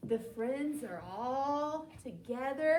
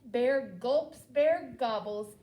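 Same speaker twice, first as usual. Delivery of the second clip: ordinary delivery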